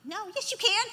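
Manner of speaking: normal talking voice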